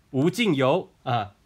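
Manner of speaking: high, loud host hype, slowed on 无尽游; hammed-up excitement. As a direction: sell a prize that never brings you back. Game show host, mock prize.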